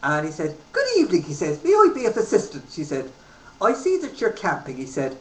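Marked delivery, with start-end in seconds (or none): 0.7-1.2 high, lilting Irish greeting; Irish accent, high and friendly. Retold or imitated. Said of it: "this little Irishman". 1.6-2.7 high, lilting Irish greeting; Irish accent, high and friendly. Retold or imitated. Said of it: "this little Irishman". 3.6-4.8 put-on Irish accent. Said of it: "this little Irishman".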